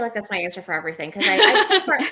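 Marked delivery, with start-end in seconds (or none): 1.1-2.0 laughing. Real, not acted. Self-mocking answer, laughing.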